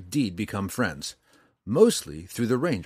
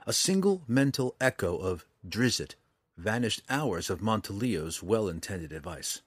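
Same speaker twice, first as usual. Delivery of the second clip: plain reading voice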